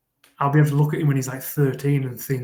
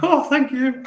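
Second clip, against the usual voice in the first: high, louder grateful dog voice; pleased dog; 13 semitones higher, 5 dB louder than usual. Acted. Dog fooled by a nice voice, high-pitched thankful reply. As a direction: pitch it right up as a delighted pet. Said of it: "in a nice voice, and they're like".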